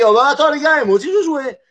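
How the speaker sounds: put-on high, quick childish joining in; eager Chinese leader. Chinese leader asks to join nuclear game, high eager voice. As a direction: chime in like a kid joining a game.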